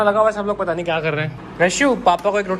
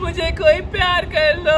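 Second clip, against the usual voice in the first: higher, louder mock-whiny shout begging for love; fake pleading; 10 semitones higher, 3 dB louder than usual. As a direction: ham up a jealous dad's plea. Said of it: "नकली acting ना करो आप".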